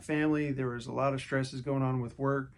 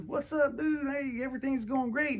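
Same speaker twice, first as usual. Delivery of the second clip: upbeat reply; 12 semitones higher than usual. Retold or imitated. His usual upbeat reply.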